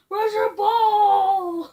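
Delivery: slow teasing dog talk; drawn-out coaxing. Real, not acted. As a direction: stretch it out to tease the dog.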